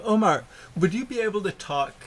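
ordinary talk

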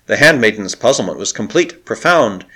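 ordinary delivery

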